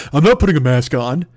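redone customer voice. Retold or imitated. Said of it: "He wasn't even a country guy. I apologize".

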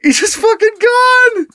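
high, stretched-out wail of laughter; real helpless giggles climbing in pitch; cracking up. Real, not acted. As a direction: squeeze the words out through laughter. Cracking up at own story.

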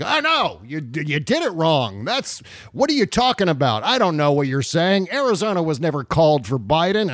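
imitating an indignant online know-it-all; mock Twitter scolding. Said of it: "scolding Twitter is on the rampage".

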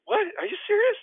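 squeaky high disbelief; can't believe he's doing this; incredulous. Retold or imitated. Rookie disbelieves prank continues, high incredulous squeak. Teammate still pretends not to know him.

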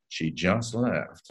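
slow, quiet, mysterious delivery; enigmatic. Shown as a demonstration. Actor reading the phone line enigmatically, slow and hushed. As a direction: say it slow and hushed, giving nothing away. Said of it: "Just be enigmatic".

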